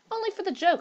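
high-pitched, eager plea; somewhat eager. A narrator's reading. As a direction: press them eagerly, pitch jumping up. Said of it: "said Hurst somewhat eagerly".

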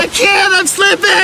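high, shouted panic; screaming while falling. Acted. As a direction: yell as you lose grip. Slipping off rope.

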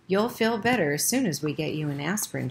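ordinary delivery, nothing marked

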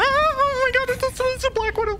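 high, fast, frightened yelp at the spider; panicked shriek. Real, not acted.

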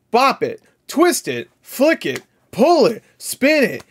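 high, punchy game commands; Bop It toy voice. Retold or imitated. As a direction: call them out like the Bop It toy. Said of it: "Everybody had a bop it".

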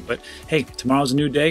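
ordinary talk, nothing marked

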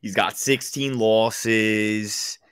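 very slow, stretched Philly voice; Philly accent. Pretend. Co-host doing the Philly voice on request, Philly accent. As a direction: drag out each word in Philly accent. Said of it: "Say, say it in the Philly voice".

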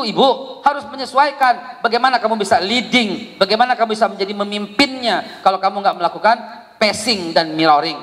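ordinary delivery

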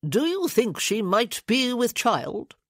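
a lightly voiced pompous committee man; officious lord pressing his point; fussy lord.

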